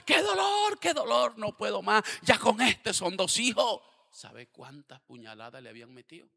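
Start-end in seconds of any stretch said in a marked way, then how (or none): 0.1-3.8 high, loud anguished lament; mother lamenting her second lost son; grieving mother. Retold or imitated. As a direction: wail out her grief to the pastor. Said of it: "llega la señora Porfiria".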